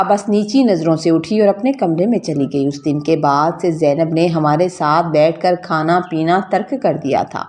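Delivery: usual speaking voice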